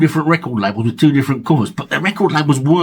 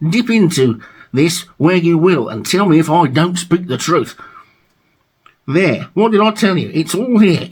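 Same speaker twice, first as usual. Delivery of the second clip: emphatic, raised read-aloud of album blurb; reading sleeve notes; 4 semitones higher than usual. Acted. Vlogger reading a DJ's praise to viewers, emphatic read-aloud. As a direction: declaim the DJ's glowing write-up.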